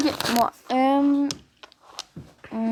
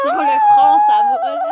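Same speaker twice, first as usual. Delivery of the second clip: very high squealing protest; shrieking protest; 6 dB louder than usual. Real, not acted. Protesting forehead kiss dare.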